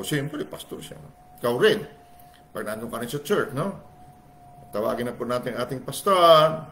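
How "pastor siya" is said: usual speaking voice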